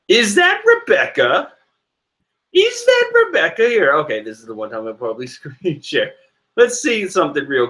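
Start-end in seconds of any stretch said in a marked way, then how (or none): 0.1-3.9 surprised. Real, not acted. Spots Rebecca on title page.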